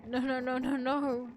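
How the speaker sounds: acted panic, only partly let out; scared screaming. A narrator's reading. Trapped narrator scared of complete silence, protesting in mild panic. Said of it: "I don't stop screaming for a moment".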